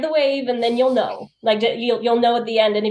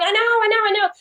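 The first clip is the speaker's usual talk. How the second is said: flustered reply; 11 semitones higher, much faster than usual. Retold or imitated. Rushing to finish, retold reply.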